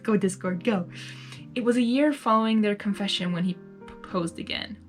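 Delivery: usual speaking voice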